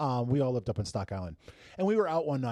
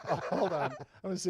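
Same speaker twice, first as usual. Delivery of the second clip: laughing; 5 semitones higher, faster than usual. Real, not acted. Laughing while fiddling with speaker.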